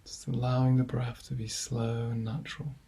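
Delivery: guided meditation. Acted. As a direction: ease listeners gently into calm, leaving long silences between phrases. Leads a body scan.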